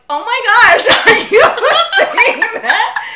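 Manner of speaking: high, loud, laughing disbelief; mock shock. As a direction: imitate scandalized onlookers, breaking into laughter. Voicing people reacting to her mimicry.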